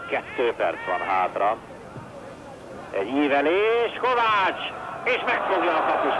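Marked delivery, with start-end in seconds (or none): 2.9-6.1 excited hungarian sports commentary. Real, not acted. Cross, keeper catches.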